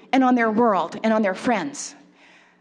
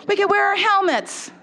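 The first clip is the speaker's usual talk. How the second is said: put-on high-pitched little kid suggestion; imitated four-year-old piping up; 7 semitones higher than usual. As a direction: offer an idea like a small child. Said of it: "let's say the four-year-old says".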